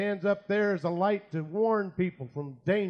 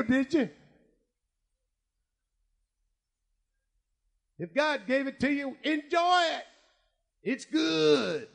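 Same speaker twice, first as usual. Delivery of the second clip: sarcastic; 8 semitones higher than usual. Acted. Sarcastic preaching at the patient.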